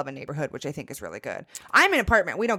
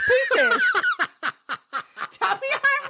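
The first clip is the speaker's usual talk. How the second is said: laughs through "Reese's Pieces" slowly; giggling, higher as it goes on; laughing; slower than usual. Real, not acted. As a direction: crack up mid-sentence. Laughing through her own point.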